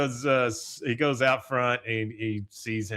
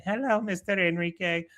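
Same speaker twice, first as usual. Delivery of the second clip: Luann; 6 semitones higher than usual. Retold or imitated. Luann greeting, high.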